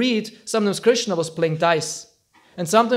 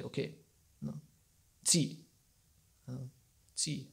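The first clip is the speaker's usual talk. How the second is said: hushed western standoff; 6 semitones lower, 18 dB quieter than usual. Retold or imitated. Gunslingers facing off in a duel, hushed and tense. Wild West duel voiced.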